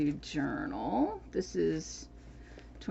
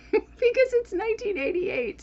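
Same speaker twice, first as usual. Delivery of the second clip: laughing; 5 dB louder than usual. Real, not acted. Laughing at her own remark.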